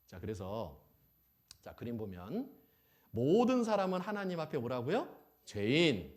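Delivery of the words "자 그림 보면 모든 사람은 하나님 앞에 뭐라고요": ordinary talk, nothing marked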